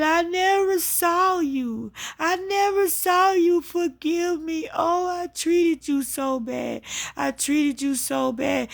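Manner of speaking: high, drawn-out wail imitating her mother; retold anguished apology, pleading at high pitch; remorseful begging. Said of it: "my mama was so sorry".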